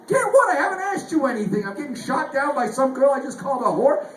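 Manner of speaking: indignant get what. Retold or imitated. Comedian rejected by a chatbot, high and outraged. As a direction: sputter as if insulted by the rejection.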